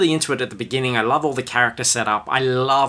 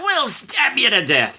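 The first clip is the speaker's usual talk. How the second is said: mock threat; 12 semitones higher than usual. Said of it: "they're like".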